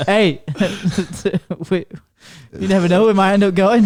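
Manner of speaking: laughing and excited. Real, not acted. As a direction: push it out through laughter.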